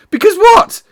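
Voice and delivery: high, loud eager question; excited boy crying out. Acted. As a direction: burst out eagerly. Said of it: "he cried eagerly".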